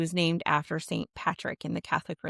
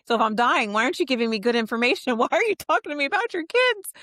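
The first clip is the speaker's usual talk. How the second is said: re-enacted panic, climbing higher and louder; panicking; 6 semitones higher, 4 dB louder, faster than usual. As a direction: retell a frantic demand, voice rising. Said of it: "And I start panicking".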